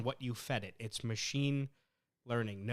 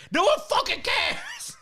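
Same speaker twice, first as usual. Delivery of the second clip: full-out high, loud angry shout; explosive re-enacted outburst; yelling; 11 dB louder than usual. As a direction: scream it back at the assistant. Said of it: "So I yelled".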